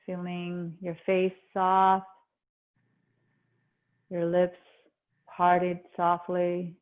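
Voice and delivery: calm meditation voice, long pauses between phrases; guided meditation. Acted. Yoga teacher easing students into breath awareness, guided meditation. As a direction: settle them gently inward, leaving space after each instruction.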